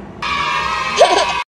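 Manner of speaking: short breathy excited giggle. Real, not acted. Telling viewers her birthday is near, breathy giggle.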